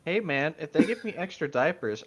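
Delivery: ordinary talk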